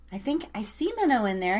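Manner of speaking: usual speaking voice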